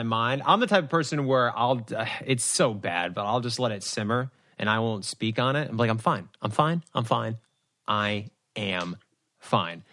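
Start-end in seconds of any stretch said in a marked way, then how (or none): 5.9-9.8 low, deliberate mock composure, pausing near the end; retold stonewalling, quiet and measured by the end; insisting, suppressed upset. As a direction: act out letting it simmer, dropping lower. Acting out simmering, refusing to speak.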